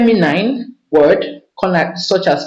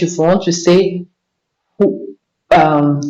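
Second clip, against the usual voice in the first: normal talking voice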